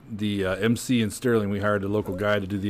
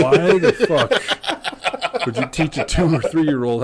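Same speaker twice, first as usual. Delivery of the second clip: slow, louder, laughing disbelief; incredulous scolding; 5 semitones higher, 4 dB louder, much slower than usual. Retold or imitated. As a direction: drag out the outrage at your dad. Scolding dad on the phone.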